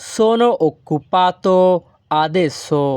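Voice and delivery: very slow, careful Italian sentence; slow Italian model. Shown as a demonstration. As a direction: spell it out slowly for learners. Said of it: "এই বাক্যের Italian ভাষা হবে".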